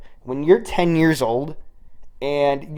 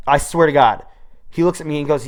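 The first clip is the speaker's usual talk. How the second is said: ordinary talk, nothing marked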